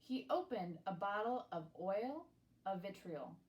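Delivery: ordinary delivery, nothing marked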